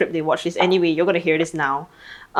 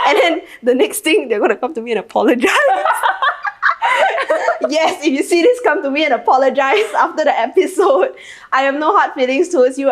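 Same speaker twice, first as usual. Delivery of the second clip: higher, laughing call-out to friends; 6 semitones higher than usual. Real, not acted. Calling out the glamping friends to apologize, laughing. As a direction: tease them while cracking up.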